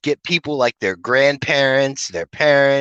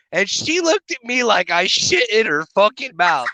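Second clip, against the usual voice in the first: ordinary delivery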